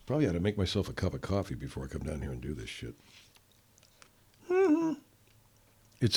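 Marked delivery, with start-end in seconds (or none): none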